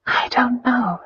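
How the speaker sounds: high, breath-filled sigh; reluctant sighing confession; sighing admission. A narrator's reading. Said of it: "she admitted with a sigh".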